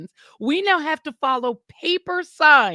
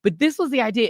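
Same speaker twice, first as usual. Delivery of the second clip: normal talking voice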